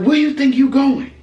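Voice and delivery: high, loud challenge; confronting shout. Retold or imitated. Wife confronting husband at the car, loud challenge. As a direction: stop him in his tracks.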